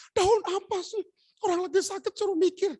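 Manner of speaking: high-pitched grumbling retort; mock high wifely annoyance; imitated irritated wife. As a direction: complain high, playing the sick wife. Husband voices irritated sick wife.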